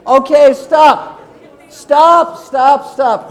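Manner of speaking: loud, high call over a noisy room. Real, not acted. Speaker halts the audience's partner discussion, calling out. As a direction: shout to halt the chatter, easing off by the end.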